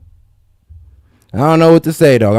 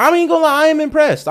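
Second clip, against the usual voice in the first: much higher-pitched, impressed admission; 12 semitones higher, faster than usual. Real, not acted. Podcast host reacting to a far-fetched news comparison, impressed. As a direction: voice jumps way up, grudgingly impressed. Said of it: "I'm impressed".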